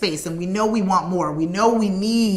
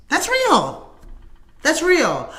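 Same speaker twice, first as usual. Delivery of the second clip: usual speaking voice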